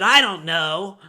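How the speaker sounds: high, loud, indignant retort; hostile customer. Retold or imitated. Rude customer answering the clerk about her pump, hostile. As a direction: throw it back at the clerk as if insulted. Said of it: "She is hostile with me".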